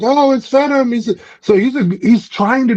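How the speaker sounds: high, startled gasp of a bystander; mock panicked crowd. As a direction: squeak it out like a frightened onlooker.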